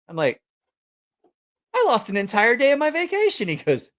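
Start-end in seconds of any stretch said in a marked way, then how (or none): 1.7-3.5 very high, incredulous retold protest; dismayed complaint. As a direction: whine it in acted dismay. Retelling own dismay.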